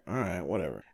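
resigned mutter. Retold or imitated. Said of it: "And I was like".

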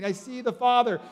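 lightly acted outcry; retold scream. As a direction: echo a man's overwhelmed cry without screaming. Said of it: "He's just screaming".

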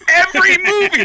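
high, loud comic outburst; exasperated shout. Retold or imitated. Comic answering a girl in the front row, exasperated yell. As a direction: yell it as if it's obvious.

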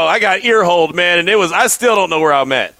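normal talking voice

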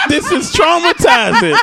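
high, slow, laughing through it; mock outrage with a laugh. Real, not acted.

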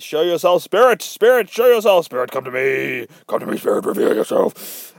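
calling spirit loudly, then fading to a hush; hammed-up medium summoning, rising loud then breathy; seance medium. Lady at the seance table summoning a spirit, mock loud then hushed. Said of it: "this fucking lady's like".

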